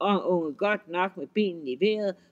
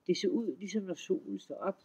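ordinary delivery

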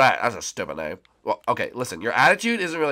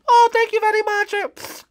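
slow, very high bunny voice; drawn-out squeaky thanks; squeaky grateful bunny; slower than usual. Acted. Bunny thanking the fan cheering it, sweetly squeaky.

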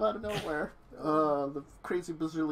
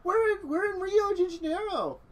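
higher character voice; 13 semitones higher than usual. Acted. Dawn asking Nathan on the phone where everyone is, high-pitched. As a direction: raise the voice into Dawn's.

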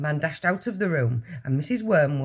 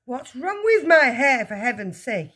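indignant; 8 semitones higher, 5 dB louder than usual. A narrator's reading. Husband answers his shrieking wife, indignant. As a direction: protest indignantly, falling at the end. Husband snaps back in acted quarrel.

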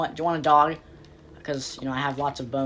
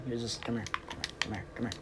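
sneaky whisper; 5 semitones lower, 17 dB quieter, faster than usual. Pretend. Sneaking up to kill villager.

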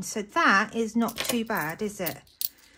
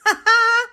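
high, loud burst of laughter; a quick squeal of a laugh; delighted laugh; 12 semitones higher, 8 dB louder than usual. Real, not acted. Laughing at the banana llama picture, delighted laugh.

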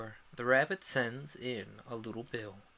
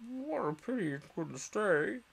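slow, higher sigh of regret; sighing Lory; 6 semitones higher than usual. A narrator's reading. The Lory sighing after the mouse leaves. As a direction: let it out as a wistful sigh. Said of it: "sighed the Lory".

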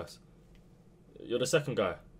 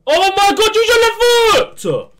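high, strained scream of frustration; anguished shout; 12 dB louder, slower than usual. Real, not acted. Gamer screaming at his striker over a missed shot, strained high yell. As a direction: yell it like the chance is slipping away.

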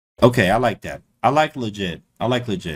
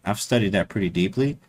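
normal talking voice

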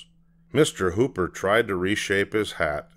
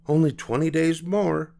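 high, cheery reassurance; bright, cheerful; 9 semitones higher than usual. A narrator's reading. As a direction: answer a child brightly, pitched up. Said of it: "Mama said brightly".

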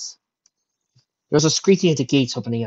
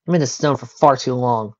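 ordinary delivery, nothing marked

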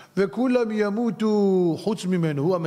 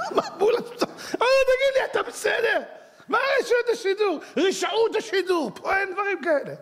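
higher, laughing, almost shouting; laughing retort. Real, not acted. Laughing retold retort to inspector.

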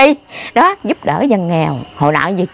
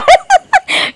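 loud, high cackling laugh; 3 dB louder than usual. Real, not acted. Woman joking with interviewer about coffee cravings, loud laughter. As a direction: burst into high laughter mid-story.

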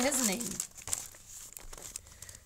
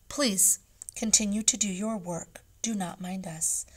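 ordinary delivery, nothing marked

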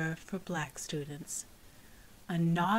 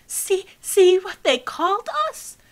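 much higher, louder anxious appeal; anxious plea; 11 semitones higher, 11 dB louder than usual. Acted. Cassie afraid the teacher has not seen the word, anxious. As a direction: urge her to see it, afraid she missed it. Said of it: "afraid that she had not seen".

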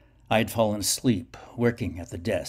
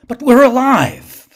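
much higher, much louder, slow cry of protest; 17 dB louder than usual. A narrator's reading. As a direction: shout it over the drone of voices. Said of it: "I protested above the babbling drone".

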